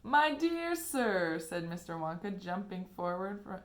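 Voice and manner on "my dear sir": grand greeting. A narrator's reading. Wonka greeting.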